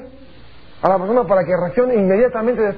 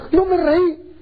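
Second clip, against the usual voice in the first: fearful denial; 8 semitones higher, slower than usual. A narrator's reading. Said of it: "porque tuvo miedo".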